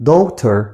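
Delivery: daughter said slowly as daw-ter, gh silent; silent gh demo. Shown as a demonstration. Teacher modelling daughter slowly for pupils. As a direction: say daughter with no gh sound. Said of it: "ال جي اتش هنا سايلنت".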